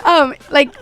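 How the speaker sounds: ordinary talk, nothing marked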